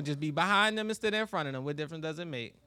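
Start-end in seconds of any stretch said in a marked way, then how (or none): none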